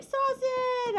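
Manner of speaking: high mock chat voice; imitating chat. Host mimicking chat typing a name, in a high voice. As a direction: squeak Saucin like the chat. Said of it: "Everyone's like".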